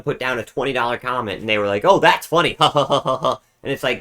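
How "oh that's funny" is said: louder, higher fake laughing reaction; mock amusement. Viewer mimicking streamers who got a twenty dollar comment, mock amused. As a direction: imitate a put-on, hollow chuckle.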